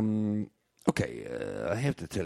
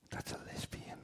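hushed confidential aside, voiceless; whisper; 16 dB quieter than usual. Acted. Bartender tipping off the customer, whispering. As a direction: lean in and breathe it so she can't hear.

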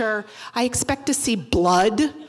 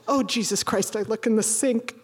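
normal talking voice

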